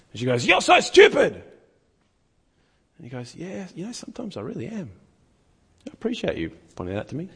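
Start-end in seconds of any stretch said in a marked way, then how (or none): 0.5-1.4 high, loud taunt; mock insult. Girl insults in second round. 3.3-7.3 much softer, disarming calm answer; calm gentle reply. Retold or imitated. Man answers insult gently.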